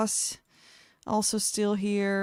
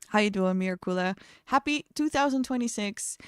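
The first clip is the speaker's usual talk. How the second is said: ordinary delivery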